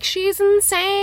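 high, slow mocking husband voice; mock gaslighter husband. Imitating the husband telling others his wife is insane. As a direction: put on the controlling husband, dragging it out.

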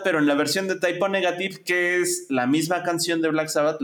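usual speaking voice

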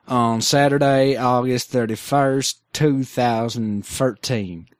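slow, drawn-out mock Jamaican Minnesotan accent for the date. Host reading the show date to cohosts in a slow mock Jamaican Minnesotan accent. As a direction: lean lazily into a Jamaican Minnesotan lilt. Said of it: "I like Jamaican Minnesota".

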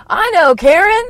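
high, loud, swooping mimicry; breezy phone voice. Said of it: "you meant to answer the phone like that".

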